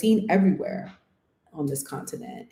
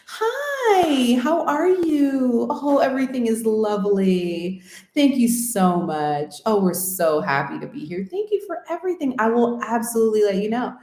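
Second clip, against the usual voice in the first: put-on American accent; 6 semitones higher than usual. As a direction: gush politely in an exaggerated American accent. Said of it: "turned on this Ameri".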